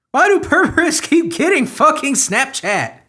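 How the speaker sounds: high, loud rant breaking into a laugh; exasperated laughing outburst. Real, not acted. Ranting to viewers about perverts, loud and laughing. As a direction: throw up your hands and laugh at the absurdity.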